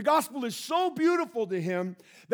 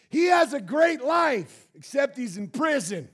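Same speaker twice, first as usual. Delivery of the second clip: mock sarcastic complaint; 5 semitones higher, 6 dB louder than usual. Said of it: "because we would go".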